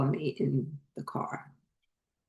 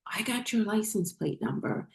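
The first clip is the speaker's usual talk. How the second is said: retold warning; 6 semitones higher than usual. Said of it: "a Spanish accent. And she's like".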